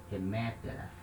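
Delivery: low, soft "remetera", weight on second syllable ME; accent kept in place. Shown as a demonstration. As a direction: stress "remetera" on ME, softly. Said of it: "o acento permanece no mesmo lugar".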